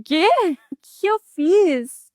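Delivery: high, slow, stunned asking what she did; shocked disbelief. Retold or imitated. Telling friends how the witches news shocked her, stunned and high. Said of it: "Aí eu fiquei".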